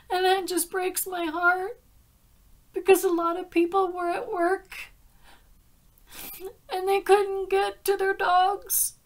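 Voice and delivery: pushing the words out through tears over lost dogs; tearful. Real, not acted. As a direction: voice high and loud, near tears, heartbroken. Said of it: "I didn't mean to cry".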